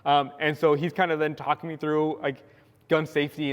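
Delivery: ordinary delivery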